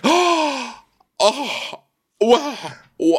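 hammed-up guilty shock, very high. Accused princess stalling before her denial, totally guilty. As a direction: feign shock with high-pitched exclamations. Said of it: "does it in that totally guilty way".

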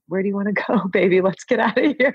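giddy, road-trip excitement; playful eager. Retold or imitated. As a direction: invite her off on an adventure. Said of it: "now I'm like".

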